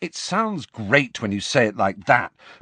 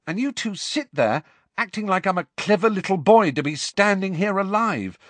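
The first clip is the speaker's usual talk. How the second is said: angry outburst; 10 semitones higher than usual. Acted. Harry's angry outburst at friends.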